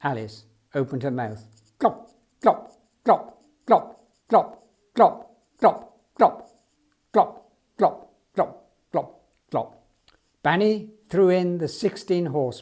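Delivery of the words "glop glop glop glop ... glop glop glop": loud, wet swallowing noises in a put-on voice; gulping sounds. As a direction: gulp each glop like a huge elephant. Alice swallowing the horse pills.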